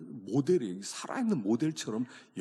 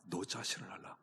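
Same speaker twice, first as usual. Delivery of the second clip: whisper; 4 semitones lower, 9 dB quieter than usual. Acted. Preacher drops to a whisper.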